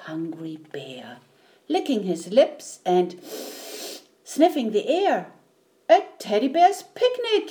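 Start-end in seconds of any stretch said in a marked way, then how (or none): 0.1-1.2 low, whispery storytelling; hushed suspense. A narrator's reading. Narrator reveals the bear, hushed and ominous. 5.9-7.5 high, excited mild bellow; bellowing bear. A narrator's reading. Bear discovers the picnic, bellowing happily. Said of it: "he bellowed".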